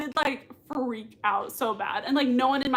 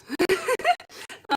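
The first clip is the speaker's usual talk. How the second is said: high laugh; 12 semitones higher than usual. Real, not acted.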